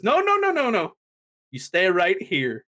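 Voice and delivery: high, insistent mock protest; urgent refusal. Acting out Roman stopping Jay.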